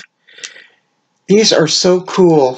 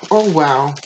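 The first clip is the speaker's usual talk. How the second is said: usual speaking voice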